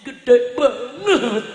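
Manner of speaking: very slow, stretched-out words; drawn-out emphasis. Real, not acted. Preacher marvels at a huge fee cut, drawn-out emphasis. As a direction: drag out each syllable for effect.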